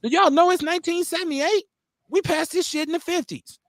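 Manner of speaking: lightly acted, high-pitched disbelief; incredulous federal judge. Judge reacting to late school desegregation, mock incredulity. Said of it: "a federal judge who looked at this shit and said".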